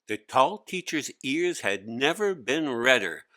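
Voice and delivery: ordinary delivery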